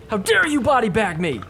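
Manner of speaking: high, loud mock outrage; a hammed-up indignant pro; mock angry player. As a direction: voice the furious player.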